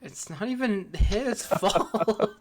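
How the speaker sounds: high, slow words broken by laughter; laughing. Real, not acted. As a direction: laugh through the protest. Laughing while protesting president blame.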